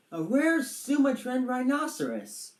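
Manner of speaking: lightly voiced pompous professor; mock professor. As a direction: play the animal expert. Professor pronouncing guess.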